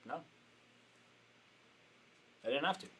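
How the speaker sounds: soft, unhurried admission; calmed down. Retold or imitated. Coach conceding to offended attendee, calm and quiet. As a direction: concede it gently and evenly. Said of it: "I calmed it down".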